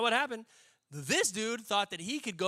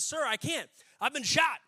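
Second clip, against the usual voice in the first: higher, urgent mock protest; raised voice, building louder; protesting soldier; 8 semitones higher, 5 dB louder than usual. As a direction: act out a wounded soldier pleading. Wounded soldier protesting to his captain.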